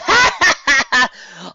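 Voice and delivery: loud mocking guffaw; barked laugh. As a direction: burst out laughing at her. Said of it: "Red Eyes barked out a laugh".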